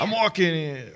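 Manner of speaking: lightly hammed-up New York actor impression; quick imitation of a famous film line; Dustin Hoffman. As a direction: echo the movie line in a Brooklyn-style accent. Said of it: "that's, uh, Dustin Hoffman".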